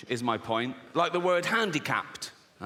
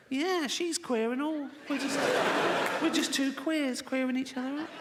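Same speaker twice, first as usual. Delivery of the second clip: high, camp sing-song voice mocking a straight lad; 8 semitones higher than usual. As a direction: lisp it up like a show-off lad claiming to be queer.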